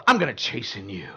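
mock villain threat to a shopper; menacing deep voice. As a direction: growl it like a villain. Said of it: "walk up to somebody in the mall and go".

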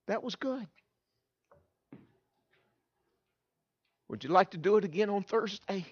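soft, hesitant teacher's invitation; imitating a softly spoken teacher; gentle praise. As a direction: offer it quietly, with long pauses. Teacher's unexpected praise after the talk.

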